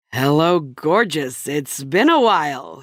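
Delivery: lightly voiced suave husband, slowed down; strange Cary Grant meets Michael Scott. Husband greets wife in bed, mock suave and slow. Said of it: "he said in some sort of strange Cary Grant meets Michael Scott tone of voice".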